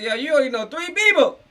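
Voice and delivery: high, loud imitation of a taunt; mocking retort. Quoting Thug's dismissive jab at the lawyer, high loud mock. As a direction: fling the line back like a jab.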